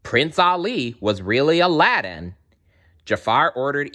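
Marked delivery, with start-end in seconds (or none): none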